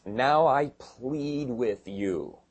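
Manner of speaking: slow, solemn reading of the text; slow scripture reading. Shown as a demonstration. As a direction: read very slowly and deliberately. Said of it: "It says".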